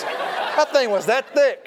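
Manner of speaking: slow, high stretch on that thick; amazed emphasis. Retold or imitated. Preacher telling congregation about the thick wallet, slow and amazed. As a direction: draw out that thick in awe.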